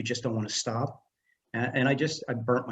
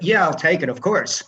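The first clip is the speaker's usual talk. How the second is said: louder, higher, jumping at the offer; eager acceptance; 5 semitones higher, 6 dB louder than usual. Retold or imitated. Jobless waiter offered a prop master job, eager acceptance. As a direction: cut in eagerly, re-enacting a yes.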